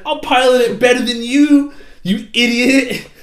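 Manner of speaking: put-on loud, high bragging kid; hammed-up childish boast; mock cocky taunt. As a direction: taunt like a bratty child, stretching the ending.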